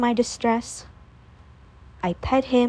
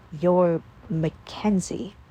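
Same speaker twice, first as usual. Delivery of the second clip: put-on slow, low, cold boyfriend voice; Dustin, stunned; 4 semitones lower, slower than usual. A narrator's reading. Boyfriend realizes he was deceived, low slow voice. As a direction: let the realization land slowly.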